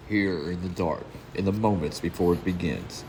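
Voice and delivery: intense, driving story narration; dramatic narration. A narrator's reading. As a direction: read it like a brooding comic-book voiceover.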